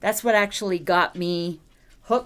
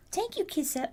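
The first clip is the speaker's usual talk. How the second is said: higher cooing thanks; 10 semitones higher, 7 dB quieter than usual. Real, not acted. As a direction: coo the thanks up high.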